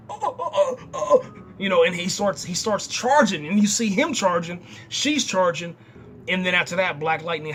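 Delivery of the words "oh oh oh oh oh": high, gasping cries of shock, lightly acted. Hero stunned by his daughter's embrace, gasping high oh oh. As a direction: imitate a stunned hero gasping as power flows back.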